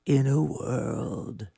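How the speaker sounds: slow, deep, raspy trailer announcer; a sick-voiced take on the movie trailer guy. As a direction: draw out each word like an epic trailer. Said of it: "do the movie trailer".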